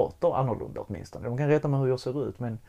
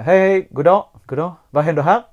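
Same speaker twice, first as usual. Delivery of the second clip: loud, high, hearty guard greeting; typical guard greeting; 5 semitones higher, 11 dB louder than usual. Retold or imitated. Guards greeting the crying child, loud and hearty. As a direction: boom a jovial security guard hello. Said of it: "du vet den här typiska".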